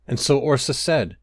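ordinary delivery, nothing marked

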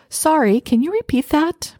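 apologetic request. Acted. Acts out needless apology example.

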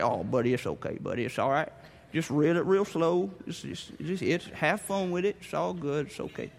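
put-on calm the kid down gently; soothing dad. Dad soothing his son on the line, low and calm.

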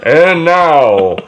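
booming stretched-out announcer intro. Pretend. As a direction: announce the band like a stadium host. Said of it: "like, an announcer".

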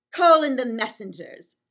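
loud, ringing order to the servants; royal command. Acted. Cleopatra summons the messengers with a loud command. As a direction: call them in like a queen.

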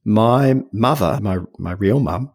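ordinary talk, nothing marked

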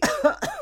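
real choking cough; coughing fit. Real, not acted. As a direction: cough hard, choking on coffee. Choking on coffee.

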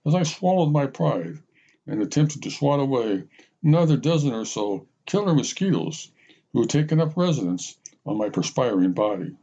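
ordinary delivery, nothing marked

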